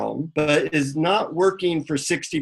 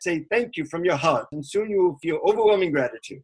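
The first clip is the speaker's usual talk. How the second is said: higher-pitched imitated Indian guru; Indian accent; 4 semitones higher than usual. Guru teaches the seeker to give thanks, imitated Indian accent. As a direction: instruct patiently in an Indian accent. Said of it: "in an Indian accent".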